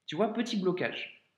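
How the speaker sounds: usual speaking voice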